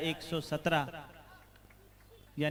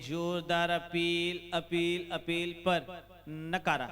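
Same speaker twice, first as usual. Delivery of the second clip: drawn-out appeal call; much slower than usual. Acted.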